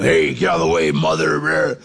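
low growled prison yard bark; mock convict growl. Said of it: "Just really growling".

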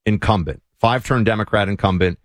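ordinary delivery

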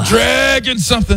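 high-pitched mock dad voice; impression of the dad. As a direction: squeak it out like the angry dad. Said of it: "Do the impression".

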